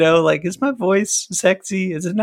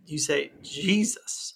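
slow, softer mock-preacher delivery; preacher's Jesus; 7 dB quieter, slower than usual. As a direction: imitate a pastor drawing out "Jesus". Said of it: "you're preaching it".

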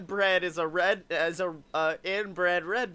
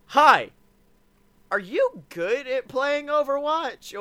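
high, slow, hammed-up newcomer; imitated stream viewer; 6 semitones higher than usual. Viewer trying to talk to a recorded stream, mock high voice. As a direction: imitate an oblivious chatter in a raised, drawn-out voice. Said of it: "the name is in Japanese".

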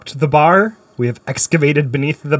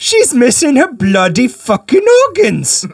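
loud, higher hammed-up London voice; mock Cockney copper; 9 semitones higher, 3 dB louder than usual. Joining the bobby skit, loud mock Cockney. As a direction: swear like a mock Scotland Yard bobby. Joins the bobby accent bit.

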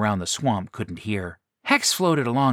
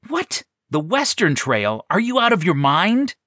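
high, loud disbelief; shocked, as if it's madness; incredulous; 8 semitones higher, 4 dB louder than usual. Retold or imitated. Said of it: "I know what you're thinking".

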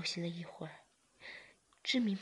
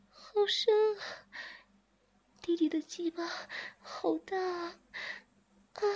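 loud moaning character voice; 12 semitones higher, 3 dB louder than usual. Acted. Zhiming plays along in the role, crying out. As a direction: cry out, overwhelmed.

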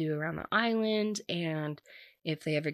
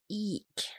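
a high, quick eek of disgust; 5 semitones higher, 6 dB quieter than usual. Real, not acted. Grossed out by lake water, a squeaky eek. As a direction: squeal eek like something gross touched you.

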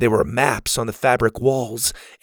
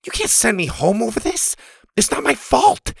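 put-on high, protesting boy voice; upset boy; 11 semitones higher than usual. A narrator's reading. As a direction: protest desperately.

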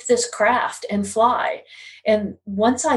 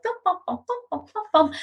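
high, soft "bum-bum" patter; imitated programming noise; 9 semitones higher, 3 dB quieter, faster than usual. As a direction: mimic busy work in high little syllables. Imitates herself rapidly programming.

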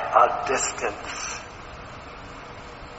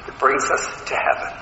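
normal talking voice

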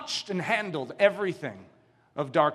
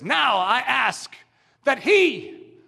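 slow, much higher, shouted demand; slow loud challenge; 7 semitones higher, 6 dB louder, much slower than usual. Acted. Gautama challenges the witch doctor before everyone, slow and shouted. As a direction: draw it out and proclaim it.

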